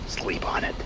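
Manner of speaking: a threat whispered close to the ear; menacing whisper. Acted. Villain to his listener, whispered threat. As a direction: breathe it, almost no voice.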